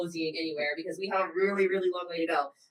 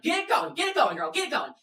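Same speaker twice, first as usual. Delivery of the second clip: higher-pitched, repeated chivvying; coaching urge; 6 semitones higher than usual. Retold or imitated. Coach pushing struggling walker, insistent urging. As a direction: urge a straggler along like a trainer. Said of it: "start trying to coach her".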